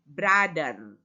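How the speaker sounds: Brother as "brader", short vowel and hard d; wrong pronunciation of brother. Shown as a demonstration. (pengucapan Brother yang salah, "brader")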